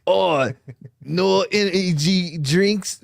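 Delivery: slow mock Scottish accent; Critical Drinker. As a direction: draw it out in a put-on Scottish accent. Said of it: "that's more like Critical Drinker".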